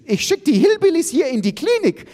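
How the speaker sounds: very high screeched threat; shrill yelping. A narrator's reading. Iris threatening the hillbillies, shrill yelping. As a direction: shriek the threat at top pitch. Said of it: "gilft Iris".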